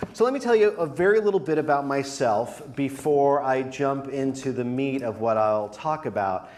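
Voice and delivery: normal talking voice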